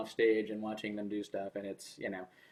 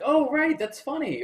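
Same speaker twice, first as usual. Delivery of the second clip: mock surprised realization; 6 dB louder than usual. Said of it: "and you're like".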